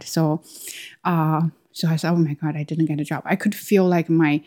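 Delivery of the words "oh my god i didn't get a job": rushed panic. Retold or imitated. Panic retold.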